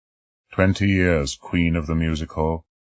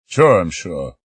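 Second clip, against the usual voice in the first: irritable; 6 dB louder, slower than usual. Acted. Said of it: "I said irritably".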